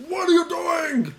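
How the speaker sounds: high, slow, drawn-out shout; mock exasperated fan. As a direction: imitate a heckler who can't believe it. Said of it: "so he's like".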